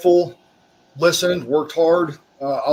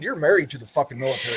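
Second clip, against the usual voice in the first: normal talking voice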